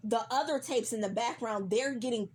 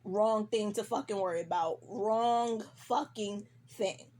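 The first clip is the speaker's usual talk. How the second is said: ordinary talk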